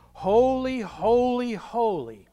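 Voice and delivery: high, loud heavenly cry; a choir of angels crying out to one another; angels calling out. Retold or imitated. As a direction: call it out like an angel across heaven. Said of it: "one called out to another".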